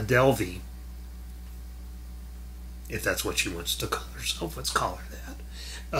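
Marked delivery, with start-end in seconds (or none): 2.9-6.0 a sudden whispered aside, much softer; whispered sarcastic aside. Real, not acted. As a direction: drop to a whisper for the snide aside. Sarcastic aside about her name.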